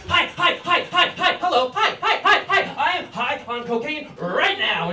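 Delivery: high, loud, rapid-fire hi's; jittery high greeting. Retold or imitated. High barista greets his manager, wired, high-pitched and loud. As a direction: babble hellos like you're wired. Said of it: "I'm like".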